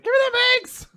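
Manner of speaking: high cartoon cat yelling for eggs; cat demanding eggs. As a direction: voice the greedy cat. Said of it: "It's like".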